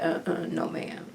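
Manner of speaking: breathy, dismissive uh-uh, almost whispered; hushed refusal. Real, not acted. Shopper refusing the long store line, hushed and dismissive. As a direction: wave it off under your breath.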